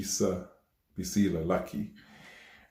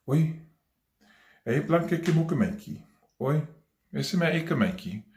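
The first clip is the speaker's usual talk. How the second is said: high-pitched imitation of mum calling at night; imitating mum calling out; 8 semitones higher than usual. Ailing mum calls out in the night, imitated high calling. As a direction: call out high, as mum would from bed. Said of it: "would call out and say".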